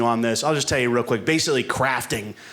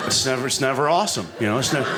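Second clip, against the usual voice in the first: ordinary delivery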